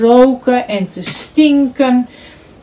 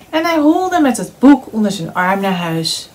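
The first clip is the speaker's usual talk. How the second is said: ordinary delivery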